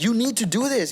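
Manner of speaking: high, insistent mock scolding; retold yelling. Father ordering his son to do better, mock yell. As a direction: press it like an upset dad, without shouting. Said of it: "he started yelling at me".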